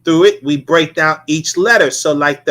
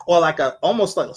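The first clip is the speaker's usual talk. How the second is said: ordinary delivery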